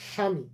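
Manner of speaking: স্বামী as shami, silent ব; palatal sh. Shown as a demonstration. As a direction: begin স্বামী with sh and no w. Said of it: "তালব্য স এর মতো". (স্বামী, তালব্য শ-এর উচ্চারণ)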